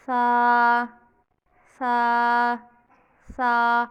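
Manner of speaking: सा said loud and clear, tongue between the teeth; correct saa sound. Shown as a demonstration. Teacher demonstrating the right सा aloud for practice. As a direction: hold सा out clearly, tongue under upper teeth. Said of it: "मैं एक दफ़ा फिर पढ़ के बताती हूँ".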